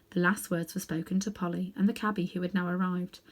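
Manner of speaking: usual speaking voice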